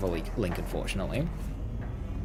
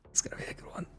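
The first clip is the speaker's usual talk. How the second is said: breathy whispered repeat; whispering; 14 dB quieter, much faster than usual. Real, not acted. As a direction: whisper it to yourself.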